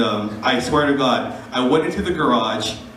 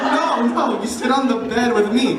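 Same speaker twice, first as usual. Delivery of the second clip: laughing coworker; 10 semitones higher than usual. Retold or imitated. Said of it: "she's like".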